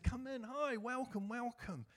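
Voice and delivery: a mild imitation of an eager greeter at the door; eager welcome. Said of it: "Tom came up to me and said".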